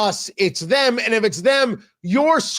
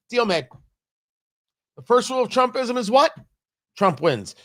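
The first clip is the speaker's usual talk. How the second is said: ordinary talk, nothing marked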